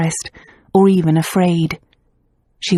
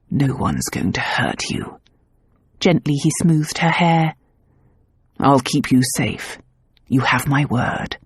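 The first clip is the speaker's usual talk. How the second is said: much lower, softer and slow; tender reassurance; 4 semitones lower, 3 dB quieter than usual. A narrator's reading. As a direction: soothe her, promise gently. Luke comforts her gently.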